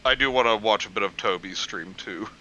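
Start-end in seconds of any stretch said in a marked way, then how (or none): none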